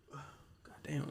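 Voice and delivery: a breathy whispered God damn; whispered curse. Real, not acted. Sneakerhead kid remembering the Jordan release, whispered. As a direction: hiss it under your breath, impressed.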